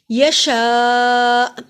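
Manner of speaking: यशा with the शा held very long; long madd. Shown as a demonstration. Teacher stretching the vowel for students. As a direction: hold the शा in यशा for four counts. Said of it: "शीन पे बड़ी मद है".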